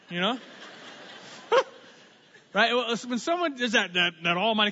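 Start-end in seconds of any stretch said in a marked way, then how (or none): 0.6-1.7 real laugh. Real, not acted. Laughs at his own joke.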